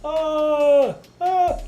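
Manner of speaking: very high pained yelps; hammed-up pain yelps; mock pain cries. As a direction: cry out like a hurt athlete. Voicing the injured player.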